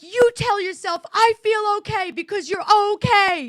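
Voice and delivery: soothing words at full volume; shouted reassurance. Retold or imitated. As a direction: mom shouting comfort over her daughter's screams. Retells shouting comfort at daughter.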